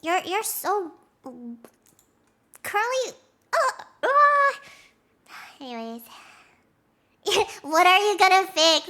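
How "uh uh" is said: high, loud wailing groan; wordless cry of exasperation; exasperated groan. Real, not acted. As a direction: let out a frustrated whine. Exasperated noises about Curly.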